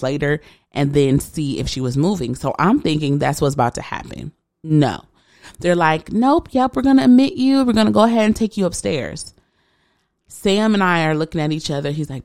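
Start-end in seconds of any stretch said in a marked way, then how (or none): 6.1-9.3 high, louder mock-staff voice; imitated hospital staff. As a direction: mimic staff brushing off her objection, pitched up. Hospital staff overruling her, voiced.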